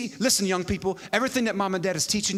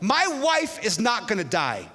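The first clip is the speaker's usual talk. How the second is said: raised pitch, emphatic refusal; defiant declaration; 5 semitones higher, 3 dB louder than usual. Retold or imitated. Husband refusing the offered year off, defiant declaration.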